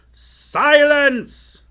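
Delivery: high, full-force shout; commanding shout. A narrator's reading. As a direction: silence everyone with one huge yell. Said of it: "shouted the enchanter again".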